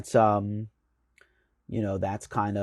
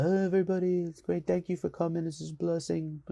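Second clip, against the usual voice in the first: much higher put-on voice of a rock star; 10 semitones higher than usual. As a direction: imitate a star greeting fans in a high voice. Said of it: "[name] comes out".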